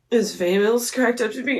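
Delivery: quick, run-together question, rising at the end; hurried question to an idol; rushed first ask. Retold or imitated. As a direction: blurt the question out in one breath. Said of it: "he was like, "What?".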